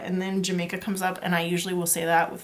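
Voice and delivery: normal talking voice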